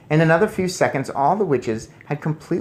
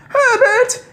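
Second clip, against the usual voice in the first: very high, loud shriek; scream; 9 dB louder than usual. Acted. Missus Jenkins shrieking for her husband, scream. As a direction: shriek the name. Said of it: "she was screaming".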